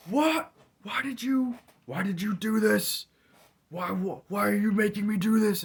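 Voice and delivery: hammed-up abuser voice of the cat, yelling way up high; cat berating its owner, mock furious high yell; mock angry cat. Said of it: "and he's like".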